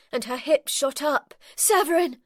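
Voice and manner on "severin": high, loud gasping cry of his name; aroused cry. A narrator's reading. Rayna crying out her lover's name, high and loud. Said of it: "A hiss exploded from between her lips".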